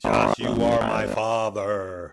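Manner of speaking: slow, laughing movie-villain breathy boom; a playful, laughing take on the Star Wars villain; Darth Vader voice. Acted. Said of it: "I see Darth Vader".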